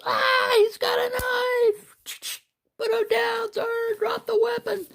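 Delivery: very high, slow, shrieking alarm then squeaky police order; hammed-up falsetto cop; panicky cartoon voices. Frantic onlooker then police officer.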